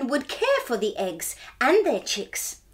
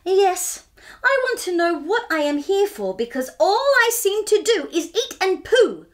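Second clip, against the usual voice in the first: higher and louder, words tumbling out; blurted out; 6 semitones higher, 4 dB louder than usual. Acted. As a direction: blurt the whole complaint out in one go. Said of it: "Cobber blurted out".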